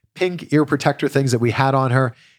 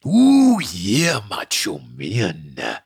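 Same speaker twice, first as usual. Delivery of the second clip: Macho Man impression; 4 semitones lower, much slower than usual. Shown as a demonstration. Dad performing Macho Man for his followers. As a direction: go full wrestling promo as Randy Savage. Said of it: "Randy Savage impression".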